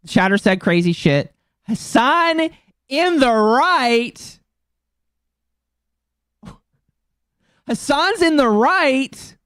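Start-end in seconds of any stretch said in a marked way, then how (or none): 1.6-9.4 slow, higher, drawn-out mocking voice; mocking quote. Streamer mocks Anna Valens' reply to a community note, in a slow, high, drawn-out mocking voice. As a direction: stretch each word out like a sneering parody. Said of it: "And then Anna Valens said".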